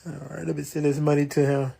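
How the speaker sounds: ordinary talk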